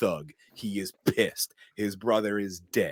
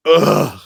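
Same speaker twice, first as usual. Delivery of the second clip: loud exasperated ugh; frustrated groan; 3 semitones higher, 13 dB louder than usual. Real, not acted. Reacting to the dispatchers, a loud frustrated groan. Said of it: "frustrated groan".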